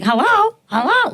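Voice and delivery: high chirpy parrot hello; parrot saying hello. Retold or imitated. Macaw copying the phone greeting, high parrot hello. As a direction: chirp it up high like a talking bird. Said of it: "like that".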